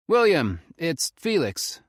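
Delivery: put-on urgent young man on the phone; Felix calling. A narrator's reading. Felix phoning the ranger.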